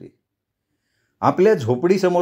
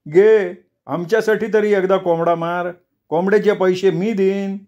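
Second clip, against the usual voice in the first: pleading villager; 6 semitones higher than usual. Acted. Sonya pleading for a chicken.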